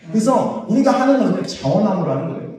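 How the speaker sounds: ordinary delivery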